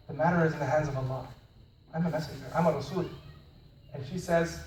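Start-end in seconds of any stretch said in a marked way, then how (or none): 0.1-3.0 calm fatherly reply. Retold or imitated. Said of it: "very calmly".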